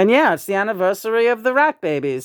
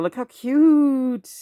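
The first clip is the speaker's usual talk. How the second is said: cooing; 5 semitones higher, much slower than usual. Real, not acted. Cooing over squirrel puppets.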